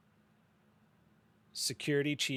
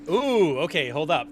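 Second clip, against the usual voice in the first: surprised; 6 semitones higher, 14 dB louder, slower than usual. Real, not acted. GM surprised by rifle.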